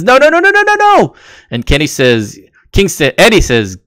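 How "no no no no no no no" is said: rapid string of very high-pitched noes; Don Callis in a panic. Retold or imitated. Podcaster imitating Callis protesting on the mic, frantic high cry. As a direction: imitate a frantic objection, voice shooting way up. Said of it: "Callis on the mic says".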